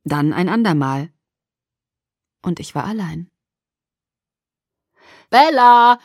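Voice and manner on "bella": loud, raised call of a father. A narrator's reading. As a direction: call her name through the house. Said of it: "rief Charlie".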